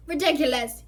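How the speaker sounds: loud shout; determined boy shouts the spell. A narrator's reading. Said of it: "he shouted".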